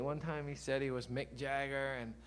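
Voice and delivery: ordinary talk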